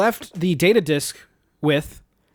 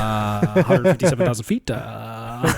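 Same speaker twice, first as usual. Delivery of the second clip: lightly voiced cockpit announcement; a hint of droning pilot; airline pilot; 6 semitones lower than usual. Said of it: "He was a pilot".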